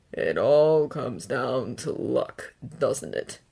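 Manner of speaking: slow, low villain drawl; menacing drawl. A narrator's reading. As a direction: draw it out with contempt. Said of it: "Stain drawls".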